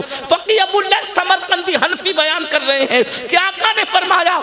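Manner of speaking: impassioned, shouted sermon climax; full-force preaching. Real, not acted. Preacher quotes the Prophet disowning those who skip prayer, full-force preaching. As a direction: hammer the warning out to the crowd.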